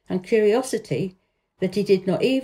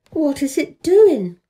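slow, loud, higher wondering cry; eager cry; 7 semitones higher, 7 dB louder, slower than usual. A narrator's reading. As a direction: cry out in amazement. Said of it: "cried Colin".

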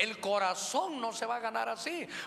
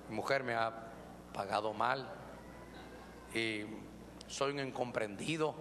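low, soft self-pitying complaint; lower, quieter, mock-wounded husband; whiny married man; 9 semitones lower, 5 dB quieter than usual. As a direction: play the misunderstood husband begging sympathy. Married man's sob story.

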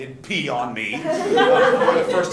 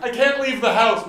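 high, louder panicked quip; mock anxious whine; 8 semitones higher, 6 dB louder than usual. Comic mocking someone with full-blown anxiety, higher and louder.

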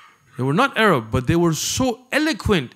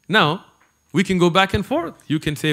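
usual speaking voice

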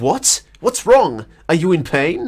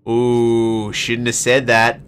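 ordinary talk

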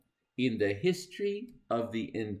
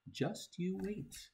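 softer, slower, higher teasing; teasing hushed promise; 7 semitones higher, 9 dB quieter, slower than usual. Acted. Reader teasing the kid, hushed and slow. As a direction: hold back a sly promise, slowly.

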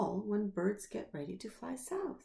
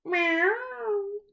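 meow; 12 semitones higher, 7 dB louder than usual. Acted. Cat sound in bird story.

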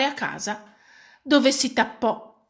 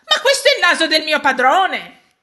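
loud, raised gasp of recognition; horrified exclamation; 4 semitones higher, 8 dB louder than usual. A narrator's reading. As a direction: cry out in horror at the market. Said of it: "esclamò inorridita". (esclamazione inorridita, forte)

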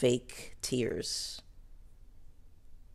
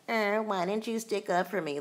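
mocking whiny Bryn; 7 semitones higher than usual. Mocking Bryn's whiny complaint.

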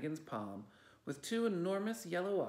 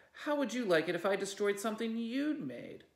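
higher, louder furious demand; angry cry; 8 semitones higher, 5 dB louder than usual. A narrator's reading. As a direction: hurl the question back at her. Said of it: "Felicity cried".

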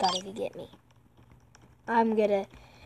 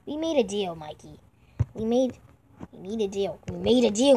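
ordinary delivery

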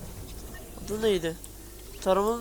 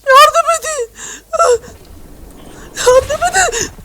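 loud very high wailing cries; loud high mock crying plea; mock cry for help; 11 dB louder than usual. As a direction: wail for help, hammed up. Gamer cries for help in game.

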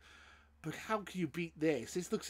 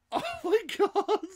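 high, loud, laughing squeal; real giddy disbelief, pitch shooting up; elated outburst; 7 dB louder than usual. Real, not acted. Gamer as the trailer ends, elated outburst.

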